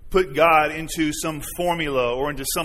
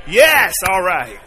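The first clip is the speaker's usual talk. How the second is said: shout; 6 dB louder, slower than usual. Real, not acted. Calling out to the congregation.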